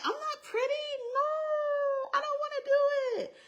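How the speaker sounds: very high, squeaky retold wail; mock crying. Young girl refusing God at the mirror, mock crying. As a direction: whine it up high, drawing out "no". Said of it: "And I cried".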